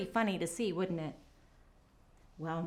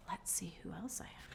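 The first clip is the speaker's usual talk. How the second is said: whisper; 4 semitones lower, 17 dB quieter than usual. Real, not acted. Whispered to children.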